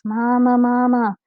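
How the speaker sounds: high, loud wailing for Mama. Acted. Terrified baby rat wailing for its mother, high wail. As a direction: cry out like a terrified little one. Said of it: "wailing its head off".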